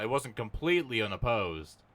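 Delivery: usual speaking voice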